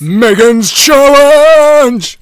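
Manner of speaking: high, loud, slow drawn-out title call; big hype intro for the challenge; show announcer. Acted. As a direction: announce it like a game show host.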